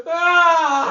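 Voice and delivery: high, loud yawning cry; imitated loud yawn. Co-host imitating his weird yawn, high loud cry. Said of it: "He was like".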